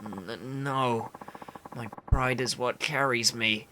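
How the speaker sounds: weak, halting, solemn; frail but dignified game character; cling to pride with little strength; starving proud man. Acted.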